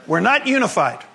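normal talking voice

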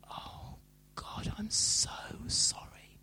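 slow, hushed apology; voiceless, drawn-out contrite whisper; repentant whisper. Retold or imitated. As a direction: whisper a heartfelt prayer of regret. Acting out repentance on being shown.

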